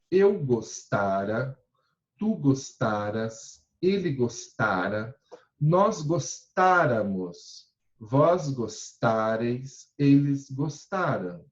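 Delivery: slow, deliberate conjugation drill; teacher drilling verb forms; slow recitation. Shown as a demonstration. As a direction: spell out each form slowly for the class. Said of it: "teacher reciting conjugation slowly".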